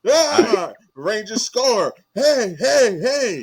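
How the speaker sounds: high, loud giddy fan voice; mock an overexcited fan; imitating Roz. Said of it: "that was the look on Roz's face".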